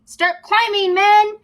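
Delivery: high, loud command; ordering. Acted. Captain ordering his men up the cliff, loud high command. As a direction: bark the order. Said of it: "said the captain".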